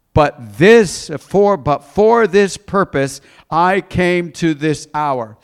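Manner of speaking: loud, drawn-out plea from the pulpit; dramatic scripture reading. Acted. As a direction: cry the prayer out slowly to the congregation. Reads scripture aloud.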